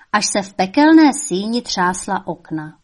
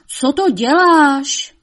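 high, loud, slow devil voice; shrieking devil; 7 semitones higher, 7 dB louder, slower than usual. A narrator's reading. Devil asking his mother what she is doing, shrieking. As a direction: stretch out the outraged question. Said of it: "zavřeštěl čert".

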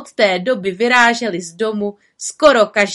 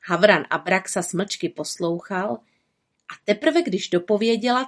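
plain reading voice

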